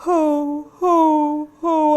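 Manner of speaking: high, loud sobbing "ho, ho, ho"; mock sobbing ho. Shown as a demonstration. Teacher demonstrating a sob to find the tilted larynx. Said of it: "if you sob".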